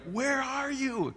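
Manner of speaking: high, slow anxious daughter voice; daughter on the phone. Retold or imitated. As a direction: play the confused daughter calling out.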